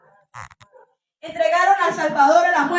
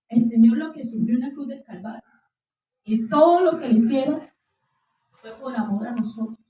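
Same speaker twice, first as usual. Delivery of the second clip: normal talking voice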